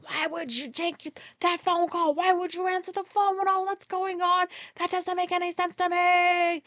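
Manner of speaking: high, strained rant, re-enacted; sustained exasperated mock screech; losing it. Driver berating passenger for taking that phone call, losing it. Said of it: "I lost my shit".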